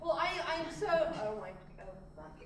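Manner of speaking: ordinary talk, nothing marked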